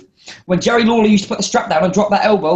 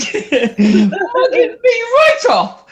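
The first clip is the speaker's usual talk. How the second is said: high, slow, laughing whine; helpless laughing squeal; 3 dB louder, much slower than usual. Real, not acted. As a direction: crack up while you say it. Laughing at kicked-out finish.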